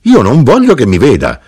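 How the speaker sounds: high, loud objection; exclaiming in protest. A narrator's reading. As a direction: protest with rising agitation. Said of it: "esclamò la vedova".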